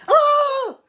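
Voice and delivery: high, loud mock scream; imitated scream. Reviewer imitating Sally screaming at the gorilla, high shriek. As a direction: let out a short hammed-up shriek. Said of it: "wide-eyed screaming".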